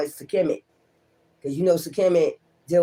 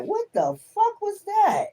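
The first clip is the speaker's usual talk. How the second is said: much higher, stunned "what the fuck was that"; stunned disbelief. Real, not acted. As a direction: shoot the voice up in disbelief. Reacting to the cards in disbelief.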